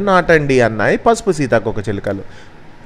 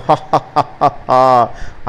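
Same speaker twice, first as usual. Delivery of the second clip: slow, staccato villain's ha-ha; acted wicked wolf laugh. A narrator's reading. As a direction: laugh out a threat in separate beats. Wolf's villain laugh after threat.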